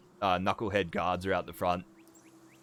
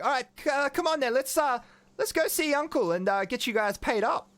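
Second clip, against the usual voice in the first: high-pitched, eager niece voice; Kenna; 10 semitones higher, 4 dB louder than usual. Acted. Kenna leading the party to Uncle for payment, high and eager. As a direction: urge them along in a high voice. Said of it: "She's like".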